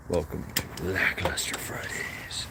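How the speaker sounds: slow, low whisper; hushed show intro. Pretend. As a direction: whisper the show's welcome, drawn out.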